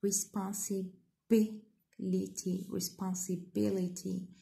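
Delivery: "Responsibility" slowly, with BI set apart and stressed; stress on third-last syllable. Shown as a demonstration. Teacher showing stress third from the end, "Responsibility" stressed on BI. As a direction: lean on BI in "Responsibility": responsi-BI-lity. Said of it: "the stress يجي فوق".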